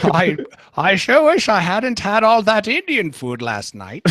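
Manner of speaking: put-on high old British lady accent; an old woman's British accent, pitched high. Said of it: "I don't know what kind of accent that is".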